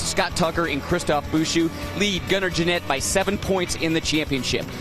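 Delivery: normal talking voice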